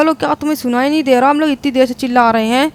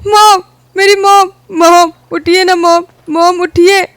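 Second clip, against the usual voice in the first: much higher, louder anguished cries; wailing grief; 5 semitones higher, 6 dB louder, slower than usual. Acted. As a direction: beg mom to wake, sobbing. Son wailing over dead mother. (माँ को उठाते हुए रोते-बिलखते)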